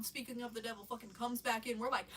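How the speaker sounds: ordinary talk, nothing marked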